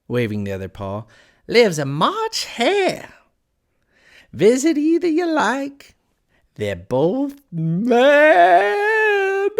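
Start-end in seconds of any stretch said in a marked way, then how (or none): 1.5-9.5 high, loud, very drawn-out cat voice; manic Cheshire Cat. A narrator's reading. Cat describing the mad neighbours, loud, high and slow. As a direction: build to a long crazed cry on the last word.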